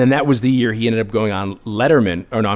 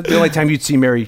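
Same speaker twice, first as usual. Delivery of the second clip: normal talking voice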